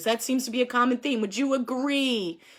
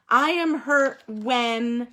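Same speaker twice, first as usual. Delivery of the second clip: slow prompt; much slower than usual. Shown as a demonstration. Teacher drawing out sentence starter.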